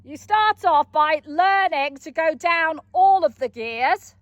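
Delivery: ordinary talk, nothing marked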